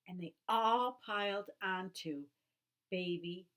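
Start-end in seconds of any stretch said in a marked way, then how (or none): none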